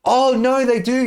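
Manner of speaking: ordinary talk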